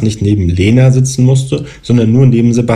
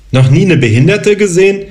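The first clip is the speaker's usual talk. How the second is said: higher, louder angry snap; snapping at him; 10 semitones higher, 4 dB louder than usual. A narrator's reading. Lena snaps at Paul for staring at her legs. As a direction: bite his head off for staring. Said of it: "herrschte sie Paul jetzt an".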